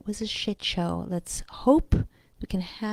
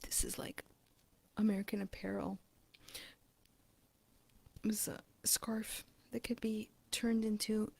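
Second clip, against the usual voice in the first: whispered aside; 10 dB quieter than usual. Real, not acted.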